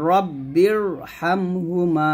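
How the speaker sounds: রব্বির drawn out slowly with a thick, heavy R; heavy R in recitation. Shown as a demonstration. Teacher to students, modelling a heavy R in রব্বির. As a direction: linger on রব্বির and make the R full. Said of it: "এই যে এখানে রটা মোটা হবে".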